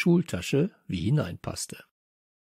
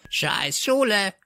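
high, drawn-out parrot croak; parrot squawk; 9 semitones higher, slower than usual. Acted. Parrot cursing after departing pupils, high drawn-out squawk. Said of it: "krächzte Störtebeker uns nach".

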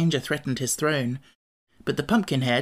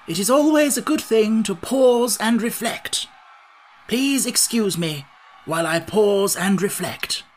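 raised, emphatic pronouncement; announcing; 7 semitones higher, 4 dB louder than usual. A narrator's reading. Scarecrow excuses himself to think, announcing. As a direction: declare it as sage advice. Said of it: "he announced".

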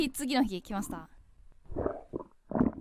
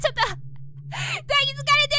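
startled exclaim; 9 semitones higher, 3 dB louder, faster than usual. Real, not acted. Sudden ghost hug on screen.

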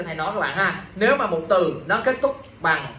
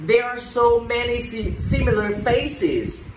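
slow, drawn-out model sentence; slow pronunciation demo; 5 semitones higher, slower than usual. Shown as a demonstration. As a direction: stretch each word for learners to copy, higher at the start. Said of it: "các bạn phải có trọng âm". (đọc mẫu chậm, kéo dài từng từ)

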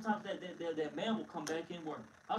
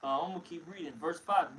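usual speaking voice

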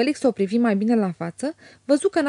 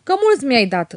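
louder mocking jab; sneering grin; 4 semitones higher, 6 dB louder than usual. A narrator's reading. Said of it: "îi rânji bătrâna". (servitoarea rânjește batjocoritor, mai tare)

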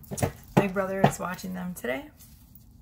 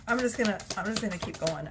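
normal talking voice

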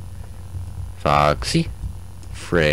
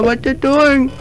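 squeaky mock voice. Mock high voice to game.

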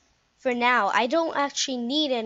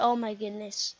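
ordinary delivery

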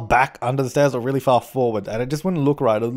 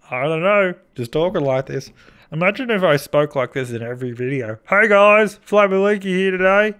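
daft put-on voice, lifting higher for the greeting; stupid voice; 4 semitones higher than usual. Vlogger mock-greeting viewers of a channel, in a stupid voice. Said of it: "Whenever I do a stupid voice".

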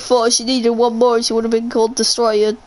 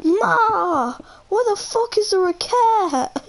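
high, whiny cry of an outraged son; Italian guy meme; 7 semitones higher than usual. Retold or imitated. Imitating the Italian guy complaining about a cat to his mum, a high, whiny wail. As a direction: wail it like the man in the video. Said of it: "and he goes".

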